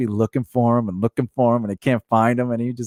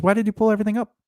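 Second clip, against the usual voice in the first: high-pitched mimicked complaint; exasperated manager; 10 semitones higher, much faster than usual. Manager returning to check the shelves, exasperated. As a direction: imitate a baffled boss, pitched up. Manager questions cashier, retold.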